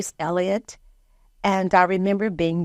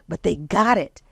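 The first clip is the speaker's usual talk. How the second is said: ordinary talk, nothing marked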